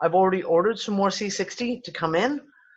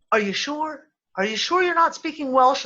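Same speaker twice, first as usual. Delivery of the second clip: retold disbelief; 8 semitones higher than usual. Retelling doubt at friends' Welsh speech.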